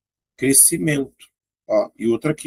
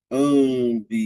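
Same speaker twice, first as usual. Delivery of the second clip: ambiente stretched out very slowly; slow demo. Shown as a demonstration. Teacher slowly pronouncing ambiente for the class. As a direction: draw out ambiente syllable by syllable. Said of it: "Olha a palavra ambiente".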